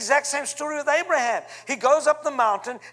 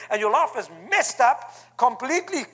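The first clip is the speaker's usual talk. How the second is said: ordinary talk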